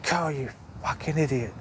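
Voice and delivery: mock-telling himself off with a chuckle; laughing self-scold. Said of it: "I'm always swearing to myself".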